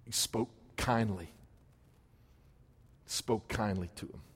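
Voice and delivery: hushed, tender words; softly spoken. Real, not acted. Preacher softly telling the church how he spoke kindly. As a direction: let it fall soft and gentle.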